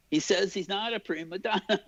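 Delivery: high-pitched relay to the room; Mel Brooks. Retold or imitated. Director reports to whoever is in the room, high imitated call. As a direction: imitate a boss calling out the news, pitched high. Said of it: "turns to whoever is in the room and said".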